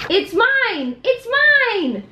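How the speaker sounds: put-on high, whiny child voice; possessive whining. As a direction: whine it like a greedy little kid. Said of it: "We act out all of them".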